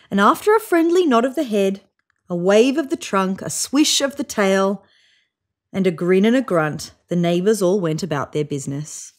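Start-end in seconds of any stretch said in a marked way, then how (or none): none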